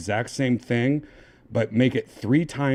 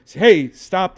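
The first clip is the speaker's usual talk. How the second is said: higher, louder quote; mock the tickled protest, pitched up; imitated tickled protest; 8 semitones higher, 9 dB louder than usual. User quoting the assistant's line back, louder mock protest. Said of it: "at the end of saying".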